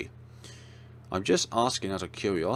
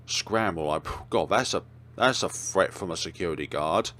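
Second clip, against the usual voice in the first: usual speaking voice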